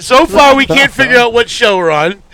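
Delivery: high, loud, slow exasperated laughing; cracking up in disbelief; laughing exasperation. Real, not acted. Host laughing at the Ted Danson mixup, loud and slow.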